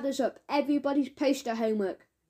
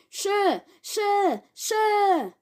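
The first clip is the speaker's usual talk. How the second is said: calling out; 8 semitones higher, 5 dB louder, much slower than usual. Acted. Marcus eagerly calling out.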